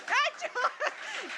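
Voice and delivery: high, laughing cry of a name; high laughing shout of surprise; laughing exclamation. Real, not acted. Laughing at repeat winner.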